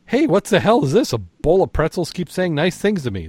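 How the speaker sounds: freaking out. A narrator's reading. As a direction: pitch it up like a rattled man at the bar. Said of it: "Starting to freak out".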